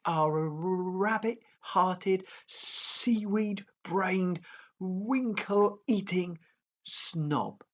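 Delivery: high, very slow insults; taunting dragon. A narrator's reading. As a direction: stretch each insult out.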